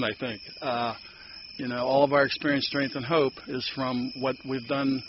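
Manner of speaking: normal talking voice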